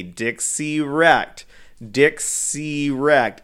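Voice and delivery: "Dixie Wrecked" stretched very slow, syllable by syllable; said slowly. Shown as a demonstration. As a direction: lead the crowd through "Dixie Wrecked" slowly. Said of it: "say it slowly with me".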